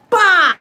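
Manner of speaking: punchy loud bow; loud bow sound effect. Retold or imitated. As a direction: shout the hit sound. Hits him with mirror.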